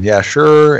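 high, loud eager agreement; eager yes. Retold or imitated. Retelling how they agreed, loud and eager. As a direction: jump at the offer. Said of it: "And we said".

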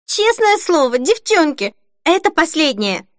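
high, pleading promise called out; excited cry. A narrator's reading. Denise to her girlfriends from the fitting room, crying out. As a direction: call it out high and insistent. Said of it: "крикнула Денис".

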